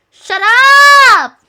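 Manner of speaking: high, loud shout; yelled retort. Pretend. Streamer shutting down teasing chat, yelled retort. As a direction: yell it, stretching the last word.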